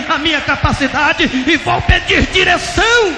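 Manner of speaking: high, full-force shouted promise; impassioned vow. Retold or imitated. Pastor promising God to pray, shouted desperate vow. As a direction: cry it out, driving to a climax.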